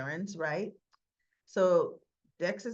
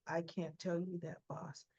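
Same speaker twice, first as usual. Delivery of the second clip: hushed refusal; 3 semitones lower, 9 dB quieter than usual. Retold or imitated. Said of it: "He says".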